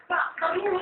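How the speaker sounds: panicked plea. Retold or imitated. Kid begs dad to stay.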